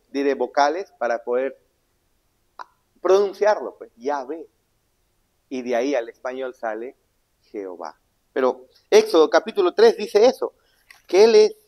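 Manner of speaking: normal talking voice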